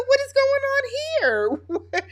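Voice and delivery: high-pitched, drawn-out disbelief; mock shock. Recapper calling out two flirting ladies, mock shock. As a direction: demand it in a high, stretched-out voice.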